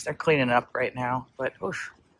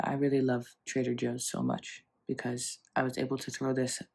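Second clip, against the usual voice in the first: ordinary delivery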